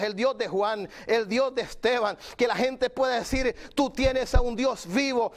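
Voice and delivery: insistent, ringing pulpit delivery; fervent preaching. Real, not acted. Preacher proclaims the God of Daniel to believers, fervent preaching. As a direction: drive each repeated phrase home to the congregation.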